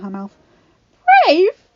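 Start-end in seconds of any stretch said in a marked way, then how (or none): 1.3-1.7 disbelieving. A narrator's reading. Disbelieving echo.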